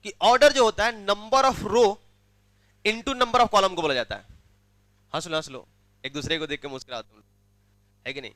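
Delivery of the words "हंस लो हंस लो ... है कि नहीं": low, soft sarcastic aside to students; quiet chiding. Real, not acted. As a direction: drop the voice and needle the gigglers. Chiding students who are laughing. (धीमी आवाज़ में ताना मारते हुए)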